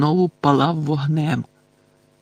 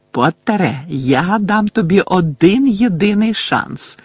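slow, higher, louder cry; Bellatrix shouting; 5 semitones higher, 4 dB louder, slower than usual. A narrator's reading. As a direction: call out the ultimatum, drawn out. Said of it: "крикнула Белатриса". (повільний гучний вигук)

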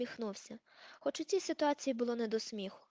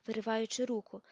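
plain reading voice

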